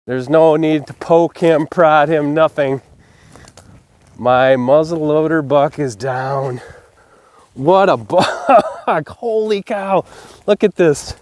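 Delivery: loud, thrilled and breathless; can't hold back the thrill; excited. Real, not acted. Hunter admiring his muzzleloader buck, loud and excited.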